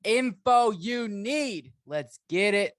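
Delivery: slow, loud, drawn-out announcer opener; big booming intro read; podcast hype intro. Acted. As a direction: sell it like a sports show open.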